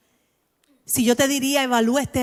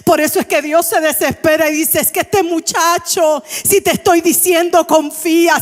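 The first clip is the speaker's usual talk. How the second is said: exasperated God; 6 semitones higher, 7 dB louder than usual. Acted. God exasperated with stubborn believer.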